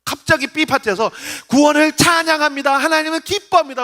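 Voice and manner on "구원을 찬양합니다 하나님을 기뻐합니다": high, loud burst of praise; acted jubilant proclamation; sudden praise. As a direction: leap into exultant worship. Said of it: "갑자기 찬양해요".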